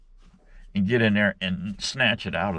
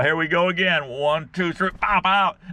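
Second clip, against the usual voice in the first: upbeat countdown to the yank; 9 semitones higher than usual. Retold or imitated. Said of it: "I said".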